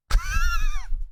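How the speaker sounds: squeaky high burst of laughter. Real, not acted. Host laughing at his accent slip, high squeaky laugh. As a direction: crack up at your own accent.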